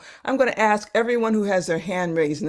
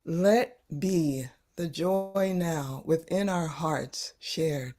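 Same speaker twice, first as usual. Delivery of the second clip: much softer, slow and tender; soft slow echo; 2 semitones lower, 6 dB quieter, slower than usual. Shown as a demonstration. Teacher echoes Julie's poem softly back to her. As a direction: lay each word down gently. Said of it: "repeating Julie's poem".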